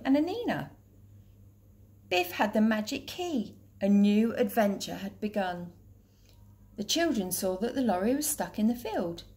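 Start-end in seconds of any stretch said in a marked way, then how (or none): none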